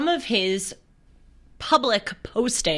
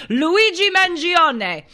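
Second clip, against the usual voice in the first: loud, high, hammed-up Italian accent; bad Italian accent; 10 semitones higher, 6 dB louder, slower than usual. Shown as a demonstration. Host showing off her favorite name, bad Italian accent. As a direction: stretch it out in a mock Italian singsong. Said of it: "in that bad Italian accent".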